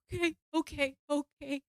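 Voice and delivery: high, small acted whimper; frightened compliance. Answering the helper's breathing advice, frightened whimper. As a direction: agree while still panicking.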